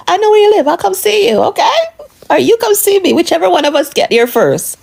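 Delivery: hammed-up high imitation of the smug husband; wife mimics husband's smug promise in a high voice; mocking husband.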